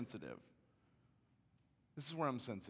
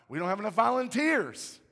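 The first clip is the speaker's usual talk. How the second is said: high, loud justification; shouted comeback; 9 semitones higher, 14 dB louder than usual. Retold or imitated. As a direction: imitate a wife arguing back. Wife shouts back in retold quarrel.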